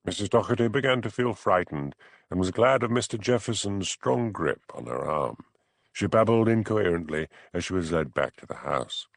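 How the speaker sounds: ordinary delivery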